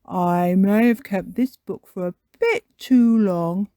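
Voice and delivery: loud, hesitant confession; Daddy Pig. Acted. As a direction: admit it sheepishly but loudly. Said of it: "says Daddy Pig".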